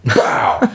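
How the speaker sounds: high, voiceless "bow" of a hit; imitated impact noise. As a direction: punch out the sound of a collision. Imitates the hit in football story.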